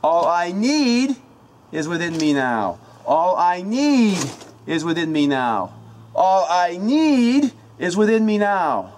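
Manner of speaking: loud, slow incantation with "need" lifted high and held; shout, stress on need. Shown as a demonstration. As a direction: climb to a belted "need", then fall away. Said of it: "the emphasis of each word".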